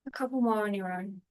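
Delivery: unhurried meditation guide with long pauses; calm, spacious guiding voice; guided meditation. Real, not acted. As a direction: ease them gently through breath and body, phrase by phrase.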